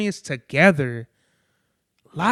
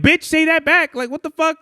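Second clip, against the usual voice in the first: indignant retort; 9 semitones higher than usual. Retold or imitated. Telling off the cashier for a lazy thank-you, indignant. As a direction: snap back at the cashier, outraged. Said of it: "I'm like".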